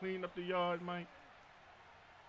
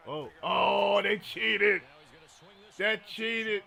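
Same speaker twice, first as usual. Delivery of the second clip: loud, real protest at the game; real outburst; 8 dB louder, much slower than usual. Real, not acted. Streamer reacting to his opponent in the game, loud real outrage. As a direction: burst out, he cheated.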